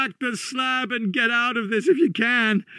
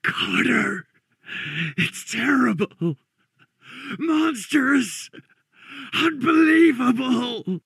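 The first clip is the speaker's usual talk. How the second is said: high, slow, anguished cries; frenzied, high-pitched terror, drawn out; frenzied horror; 11 semitones higher, slower than usual. Acted. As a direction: cry out each word in mounting horror. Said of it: "came the frenzied tones again".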